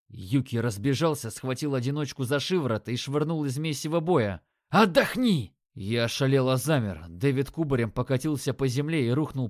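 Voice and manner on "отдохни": shouting. Acted. Yuki throws comrade out of fight.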